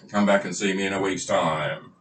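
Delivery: ordinary talk, nothing marked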